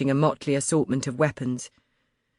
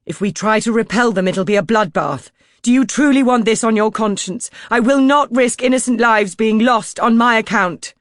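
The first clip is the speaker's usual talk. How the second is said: loud, raised, forceful appeal; impassioned plea; 7 semitones higher, 7 dB louder than usual. A narrator's reading. Narrator pleading with Crompton to spare lives, loud. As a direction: shame him out of firing.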